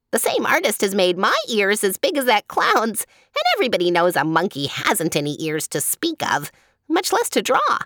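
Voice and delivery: high-pitched monkey voice, aggrieved; indignant monkey. Acted. Monkey grumbling about the artist who drew his ears, high and indignant. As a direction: play a monkey complaining in a high, lively voice.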